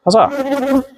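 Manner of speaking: high, hammed-up victory cry; mock triumphant cheer. Real, not acted. Beekeeper celebrating removing the varroa, mock heroic cheer. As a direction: crow like a mock hero. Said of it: "I'm a saviour".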